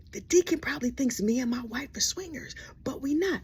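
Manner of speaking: hushed, panicked confiding; worried whisper. Retold or imitated. Freaked-out James whispering about the deacon. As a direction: whisper it like a secret.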